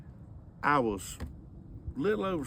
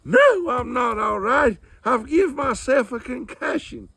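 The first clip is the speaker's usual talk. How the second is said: hammed-up injured mama complaining; mama's voice; 10 semitones higher, 9 dB louder than usual. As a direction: whine like a hurt, cranky mother. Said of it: "And she's up there".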